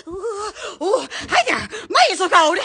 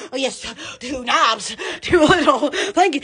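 usual speaking voice

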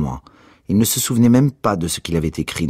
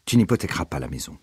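ordinary delivery, nothing marked

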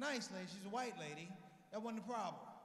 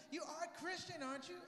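high lady voice; presuming lady; 7 semitones higher than usual. Retold or imitated. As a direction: imitate a woman fishing for a yes. Lady checks his religion.

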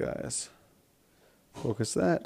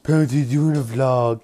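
ordinary talk

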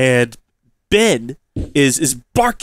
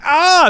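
high, louder alarmed cry; 14 semitones higher, 6 dB louder than usual. Retold or imitated. Owner hears the dog barking at the snake, alarmed cry.

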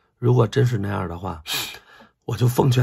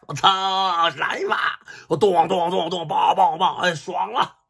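higher, louder mock brawl with punch noises; gleeful fight frenzy; 9 semitones higher, 4 dB louder, slower than usual. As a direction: play out the beating like it's a thrill. Acting out gleeful beating. (假装打人时的兴奋叫嚷)

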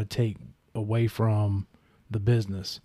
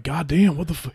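high, startled mock exclamation; shocked curse; 5 semitones higher than usual. Opponent seeing the ankle roll, high startled curse. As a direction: react as if seeing a bad injury. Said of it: "he was like".

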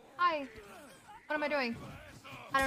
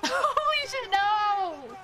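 shocked; 8 semitones higher, 3 dB louder, much slower than usual. Real, not acted. Shocked reacting to wrestler's entrance.

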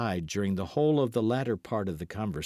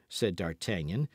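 ordinary delivery

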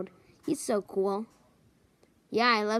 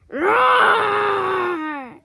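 a big shouted roar, much higher and louder; loud lion roar; 9 semitones higher, 8 dB louder than usual. Pretend. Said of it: "That was a loud roar".

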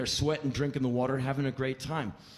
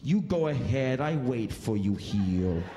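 slow, lower sulk; sulking driver; 3 semitones lower, slower than usual. Retold or imitated. Driver refuses the zoo, slow sulky voice.

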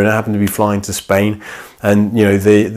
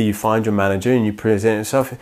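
ordinary talk, nothing marked

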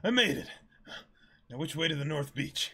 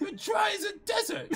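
teasing retort. Acted. Reading Usopp's retort.

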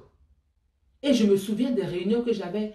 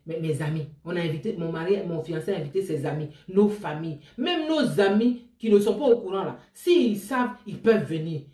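normal talking voice

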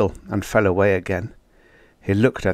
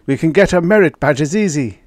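excited; 7 semitones higher, 5 dB louder than usual. Acted. Character calls out cheerfully.

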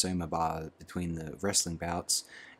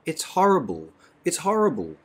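higher, louder tearful repeating; crying; 9 semitones higher, 6 dB louder than usual. A narrator's reading. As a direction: plead through tears. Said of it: "Lenina was crying".

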